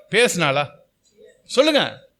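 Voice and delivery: ordinary talk, nothing marked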